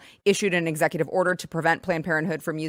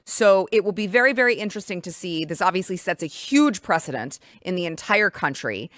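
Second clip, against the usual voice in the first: ordinary delivery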